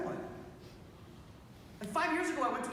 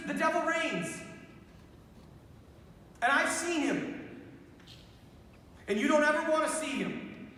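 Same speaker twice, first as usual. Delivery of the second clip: fervent preaching; 5 semitones higher than usual. Acted. Preaching at full force.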